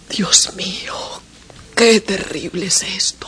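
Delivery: hushed breathless whisper; despairing whisper. Acted. The two women whispering in despair over the crying boy.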